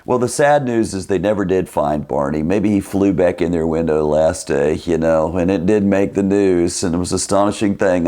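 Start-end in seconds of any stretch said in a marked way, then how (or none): none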